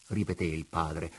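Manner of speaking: plain reading voice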